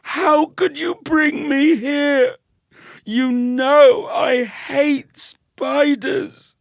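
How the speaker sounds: very high, loud, slow shriek; shrieking blame at his cousin. Acted. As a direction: shriek it in accusing panic. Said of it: "he shrieked".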